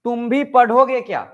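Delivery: high, loud question with भी emphasized; stress on भी. Shown as a demonstration. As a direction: punch भी as you ask. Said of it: "किस पर जोर पड़ रहा है?".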